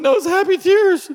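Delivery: very high, loud mock-weeping mother; hammed-up tearful imitation of mom; crying mom. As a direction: squeal it through pretend happy tears.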